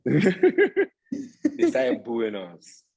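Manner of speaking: laughing through the words, slowly. Real, not acted. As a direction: chuckle it out as you tell it. Laughing while telling of booing.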